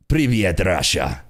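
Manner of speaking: playful fake Russian; cartoonish Russian accent, dropping low; butchered Russian accent. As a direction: greet in a hammed-up Russian accent, amused. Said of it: "I'm butchering the accent".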